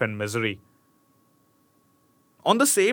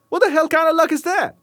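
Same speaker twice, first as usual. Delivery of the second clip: loud, high disbelief; can't believe this luck; incredulous outburst; 5 dB louder, faster than usual. Real, not acted. Reader reacts to the prince surviving the fall, loud high disbelief.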